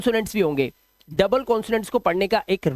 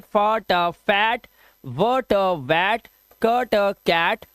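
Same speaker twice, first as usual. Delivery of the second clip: slow sounding out; much slower than usual. Shown as a demonstration. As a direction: slowly blend each sound into the word. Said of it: "सा पे ए की मात्रा लगाएंगे तो ये हो जाएगा सैट". (अक्षर जोड़कर धीरे-धीरे शब्द बोलना)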